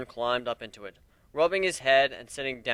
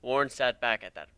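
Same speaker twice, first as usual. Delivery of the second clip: ordinary delivery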